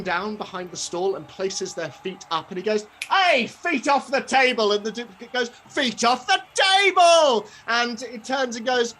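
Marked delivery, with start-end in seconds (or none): 3.1-4.7 high, loud telling-off; raised-voice stallholder's order; scolding shout. Acted. Stallholder scolds his duplicate. 5.7-7.5 much higher, louder, drawn-out echo; exaggerated high copycat yell; mimicking shout. Duplicate echoes the scolding.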